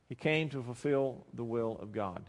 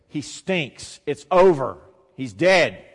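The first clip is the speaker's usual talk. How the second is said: loud, emphatic verdict; forceful voicing of a hopeless mourner; blunt protest; 12 dB louder, slower than usual. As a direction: hammer each short phrase home. Mourners' protest at tomb acted out.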